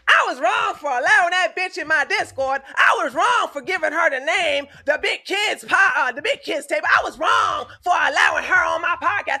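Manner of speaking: hammed-up imitation of a man owning up; Killer Steven. Streamer mocks what her rival would admit, hammed-up mock confession. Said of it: "Now, he may be wrong, like".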